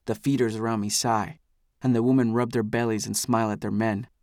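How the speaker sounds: plain reading voice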